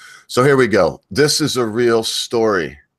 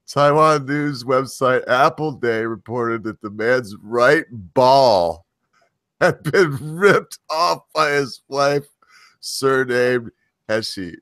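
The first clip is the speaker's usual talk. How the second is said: news read; 4 semitones higher, slower than usual. Acted. Reading news, stifling laughter.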